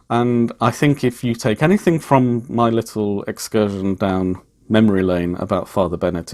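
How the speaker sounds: ordinary delivery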